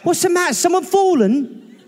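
high mock-British Lassie owner; talking to a dog. Owner asking the British dog what's wrong. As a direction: coo it at a clever dog. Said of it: "I think he's trying to tell us something".

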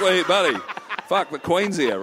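usual speaking voice

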